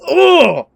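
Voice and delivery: loud, high "ugh" of loathing; disgusted groan. Real, not acted. Venting about a disliked coworker, disgusted groan.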